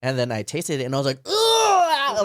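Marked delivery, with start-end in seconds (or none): 1.2-2.2 high, loud, disgusted "ugh"; freaked-out ugh. Retold or imitated. Retelling a first taste of wine to friends, freaked-out ugh. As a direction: recoil vocally from a nasty taste. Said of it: "Like, I freaked out".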